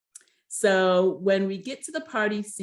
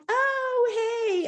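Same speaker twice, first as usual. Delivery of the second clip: much higher, falsely bright oh hey; imitating a fake friendly hello; fake greeting. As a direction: put on a fake happy hello. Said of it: "a little bit of fake".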